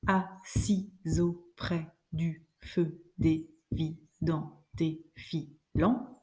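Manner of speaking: very slow, evenly spaced syllables; counting syllables. Shown as a demonstration. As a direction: chop "assise auprès du feu" into single, separate syllables. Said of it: "Donc, on va compter".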